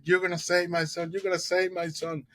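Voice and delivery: high, desperate mother begging; pleading mother. Retold or imitated. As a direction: beg the doctor for your son's life, voice up high.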